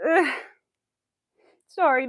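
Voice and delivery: usual speaking voice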